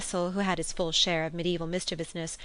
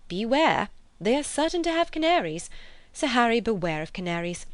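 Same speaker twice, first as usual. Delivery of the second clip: louder mock-dramatic warning; exclaimed warning; 5 semitones higher, 4 dB louder than usual. A narrator's reading. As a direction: sound the alarm about canaries. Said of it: "Mrs Honeychurch exclaimed".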